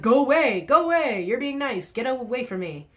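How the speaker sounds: mock shooing. Vlogger imagining shooing off runners, loud mock go away. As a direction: wave them off with a loud go away. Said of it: "Be like".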